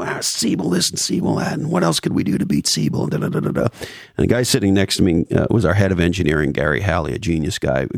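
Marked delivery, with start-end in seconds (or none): 0.0-3.7 high-pitched mock of a droning boss; rambling CEO. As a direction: imitate a boss going on and on, pitched up. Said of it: "he's going on and on about".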